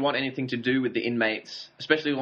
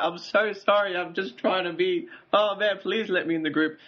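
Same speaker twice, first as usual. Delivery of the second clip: higher-pitched mock begging; pleading; 6 semitones higher than usual. Host imitates a scrawny prisoner begging the group, pleading. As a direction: imitate someone pleading to be let in. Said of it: "he was just genuinely pleading all the time".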